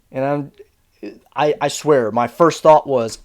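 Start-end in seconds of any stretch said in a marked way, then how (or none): none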